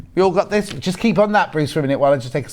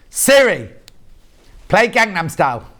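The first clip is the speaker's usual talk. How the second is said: commanding Siri; 4 semitones higher, 4 dB louder than usual. Retold or imitated. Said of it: "Bruce likes to say".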